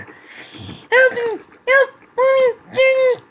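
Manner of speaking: very high, loud, frantic yelling; screaming for help. A narrator's reading. Little girl yelling for rescue in the forest, frantic high scream. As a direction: scream it out frantically at the top of the range. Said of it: "sounds of someone screaming loudly".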